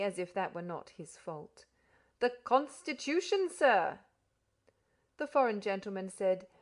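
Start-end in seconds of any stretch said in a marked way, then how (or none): 2.2-4.0 insistent. A narrator's reading. Podsnap continuing the lesson.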